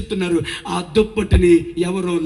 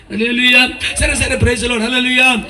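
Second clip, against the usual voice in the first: laughing hallelujah; 3 semitones higher, 5 dB louder than usual. Real, not acted. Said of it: "ఇదేం హల్లెలూయాలరా?".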